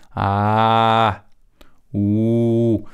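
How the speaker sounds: "A" and "U" held, fading in and out slowly; slow swelling vowels. Shown as a demonstration. Teacher showing how a vowel appears and disappears slowly. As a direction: let "A" and "U" grow and die away gently. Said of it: "Aparece lentamente y desaparece lentamente".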